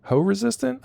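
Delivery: high incredulous squeak on hoe resistant; startled, high-pitched echo; surprised question. Real, not acted. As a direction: echo it back in disbelief, way up high.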